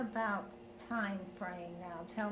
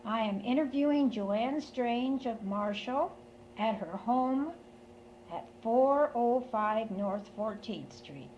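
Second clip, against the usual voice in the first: formal recording announcement; 6 semitones higher, 4 dB louder than usual. Acted. Formal oral history announcement.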